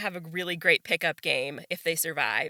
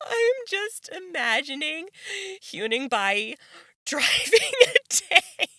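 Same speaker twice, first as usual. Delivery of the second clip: high, slow, laughing too hard to talk; helpless laughter; 8 semitones higher, slower than usual. Real, not acted. Picturing the band driving a tank, helplessly laughing. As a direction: squeeze the words out through laughter.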